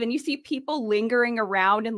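ordinary delivery

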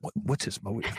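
mocking suspicious question. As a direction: mimic the critics' snide suspicion. Mock quote of suspicious critics.

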